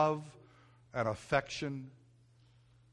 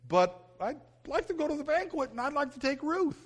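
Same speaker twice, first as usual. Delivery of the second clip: put-on much higher, boyish pleading voice; hopeful young boy; 12 semitones higher than usual. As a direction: squeak it out like an eager kid asking for something. Boy's hopeful inner voice retold.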